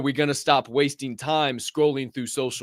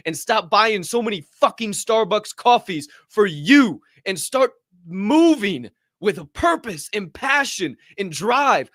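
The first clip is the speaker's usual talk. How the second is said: climbing higher and louder into a shout; building fired-up rant; 9 semitones higher, 3 dB louder than usual. Real, not acted. Host firing up his listeners to chase their goals, rising to a shout. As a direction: preach it harder and harder until you are yelling.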